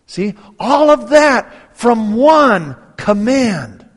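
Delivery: loud, high, slow proclamation; sermon voice, emphatic and slow; preaching emphasis. Acted. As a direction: lean into it from the pulpit. Preacher turns to point.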